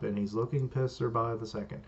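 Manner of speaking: usual speaking voice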